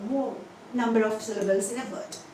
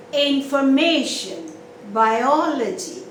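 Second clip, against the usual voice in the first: "Information" on MAY, "biology" on OL, slow and louder; stress on one syllable; 5 dB louder, slower than usual. Shown as a demonstration. Teacher giving stress examples, "Information" and "biology" each with one strong syllable. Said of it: "you give stress on one particular syllable".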